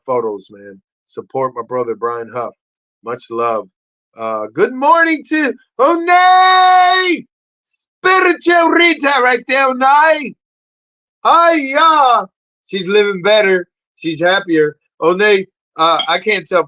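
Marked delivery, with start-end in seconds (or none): 4.5-10.4 high, loud, slow greeting; shouted greeting. Real, not acted. Host greeting viewer Onay, high shouting. 11.2-12.3 high loud "Hiya"; loud catchphrase. Real, not acted. Host greeting viewer Onay with his catchphrase, loud.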